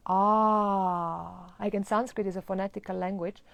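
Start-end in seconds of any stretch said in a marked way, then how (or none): 0.1-1.5 AH held long with the jaw dropped wide; sustained, wide-open "Ah". Shown as a demonstration. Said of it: "you really open the jaw".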